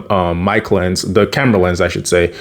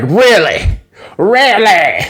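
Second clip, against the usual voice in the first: higher, exaggerated falling "really"; goofy mock disbelief; 8 semitones higher, slower than usual. [name] reacts to the pat-down, goofy. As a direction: ham it up with silly drawn vowels. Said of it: "So that was a little goofy".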